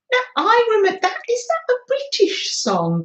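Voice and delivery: high, fast bursts of recognition; thrilled to recognise the tune; excited. Real, not acted. Excited recognition of old song.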